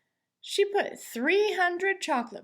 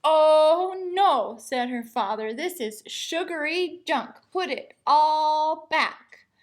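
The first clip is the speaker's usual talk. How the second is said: raised, loud parental scold; scolding father; 4 semitones higher, 7 dB louder, slower than usual. A narrator's reading. Father making his daughter put the chocolate back, loud scold. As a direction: tell her off firmly, slowing at the end.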